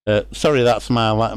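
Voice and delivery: ordinary talk, nothing marked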